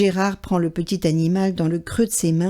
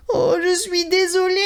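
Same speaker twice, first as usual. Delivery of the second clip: high tearful apology; on the edge of tears; sorry mouse; 9 semitones higher than usual. A narrator's reading. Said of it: "dit Souriceau".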